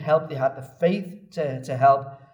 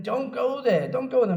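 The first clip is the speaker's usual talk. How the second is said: urgent dissuading; 7 semitones higher than usual. Retold or imitated. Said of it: "they continue to try and dissuade him".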